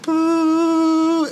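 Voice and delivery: very high eerie drawn-out "Boo"; spooky boo. Retold or imitated. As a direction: moan "Boo" like a ghost vibration. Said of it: "and it's like".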